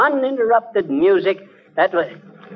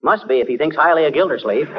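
ordinary talk

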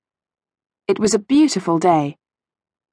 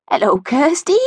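higher-pitched greeting from a granny figure; a hint of a jolly gran, calling hello; lightly voiced jolly lady; 6 semitones higher than usual. A narrator's reading. Gran greets her granddaughter at the fete, lightly jolly. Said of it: "jolly-looking lady".

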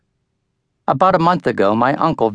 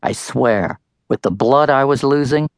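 ordinary delivery

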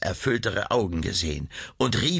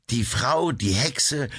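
plain reading voice

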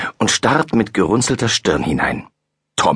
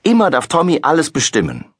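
higher, indignant complaint; girl scolding dad; 8 semitones higher, faster than usual. A narrator's reading. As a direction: grumble at the unfairness. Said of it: "schimpft Laura".

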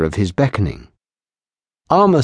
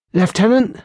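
a higher, fearful calling out; frightened call; 12 semitones higher than usual. Acted. Patrolman calling anxiously for the lieutenant, higher and fearful. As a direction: call out scared from the doorway. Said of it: "a frightened voice call out".

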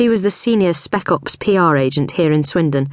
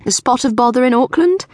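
chirpy Cordelia; 8 semitones higher than usual. A narrator's reading. Cordelia voiced in narration.

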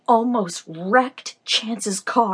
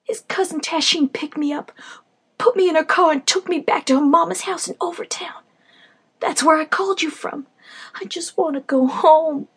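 pleading; 6 semitones higher, 3 dB louder than usual. Acted. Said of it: "Pleasure pleaded again".

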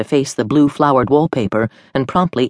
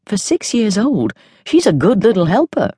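put-on a fond old woman, higher; higher grandmother voice; 7 semitones higher than usual. A narrator's reading. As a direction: say it like a proud grandma. Grandmother overheard.

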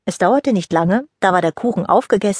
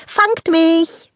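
high, bright-voiced dare; playful giggly call; 10 semitones higher than usual. Rosalie runs over the meadow daring her friends to catch her. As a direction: tease them to chase you. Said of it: "Rosalie kicherte".

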